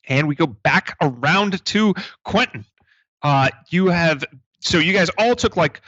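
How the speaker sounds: usual speaking voice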